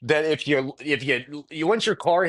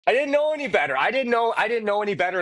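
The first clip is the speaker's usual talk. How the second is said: high defensive protest; 9 semitones higher, faster than usual. Real, not acted. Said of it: "defends himself after bad choice".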